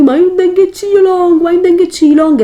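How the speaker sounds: high whiny mimicry of fussy girls; mocking whiny voice. Mocking women who want long lashes. (voce lamentosa e acuta)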